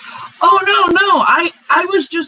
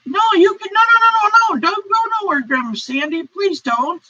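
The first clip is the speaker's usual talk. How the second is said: alarmed pleading; 8 semitones higher than usual. Real, not acted. Streamer begs viewer not leave.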